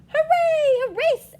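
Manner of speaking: high, loud hooray; cheering. Acted. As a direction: cheer like an excited crowd of animals. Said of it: "everyone cheered".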